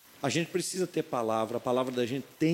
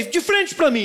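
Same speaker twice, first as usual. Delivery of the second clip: calling out; 12 semitones higher, 6 dB louder, slower than usual. Retold or imitated. Pai instrui o filho.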